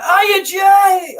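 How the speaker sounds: very high, loud shouted greeting; imitated shout. Friend calling to Jay as he films, imitated high shout. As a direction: holler it like calling someone from afar. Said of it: "Like, shouting".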